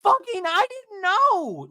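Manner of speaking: high, loud outraged rant; shrill-pitched impression of someone losing it; imitated screaming. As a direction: build to a drawn-out, high mock scream. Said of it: "Alexis is screaming".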